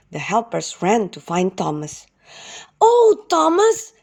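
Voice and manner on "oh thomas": high, loud, slow calling out; cry out. Acted. Helpers running to Thomas, calling his name high and loud. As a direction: call to Thomas with excitement. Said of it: "cried the helpers".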